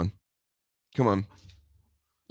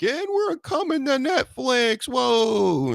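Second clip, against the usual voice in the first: much higher, slow hammed-up hype voice; mock announcement; slower than usual. Podcaster mocking wrestling stars hyping the Netflix move in a high hammed-up voice. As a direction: parody a cheesy promo line, stretched out. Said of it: "lined up to be like".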